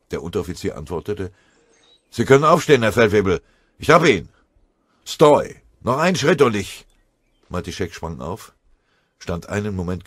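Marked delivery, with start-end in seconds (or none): 2.1-6.8 loud, high calls and orders; shouted report. A narrator's reading. Corporal calling to his sergeant while guarding the prisoner, shouting. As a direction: shout across, gun on the prisoner.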